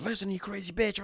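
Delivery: high put-on insulting voice; imitating angry homeless man. Homeless man cursing grandma, high mock insult. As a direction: snap it out in his voice, lightly mocked. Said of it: "he just went off and goes".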